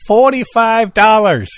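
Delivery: slow, high, loud scalper price call; name the price like a shady tout; pushy ticket tout. Pretend. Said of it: "You can sit at the front door".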